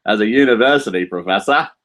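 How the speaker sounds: badly faked Australian accent; put-on Australian twang. Shown as a demonstration. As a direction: have a clumsy go at Australian. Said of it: "That's my bad Australian".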